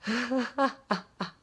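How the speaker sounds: staccato mock laugh, trailing into separate puffs; imitated laughter. She shows how she laughed at meeting, mock laughter. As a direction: act out a sudden uncontrolled laugh. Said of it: "So I started laughing".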